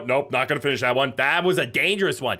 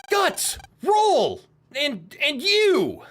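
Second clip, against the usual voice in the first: anime hero; 14 semitones higher, 4 dB louder, much slower than usual. Acted.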